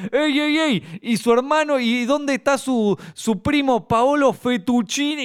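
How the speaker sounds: high, slow, triumphant pun; eager joker. Retold or imitated. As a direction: another joker topping the pun. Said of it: "aparece otro al lado que le dice".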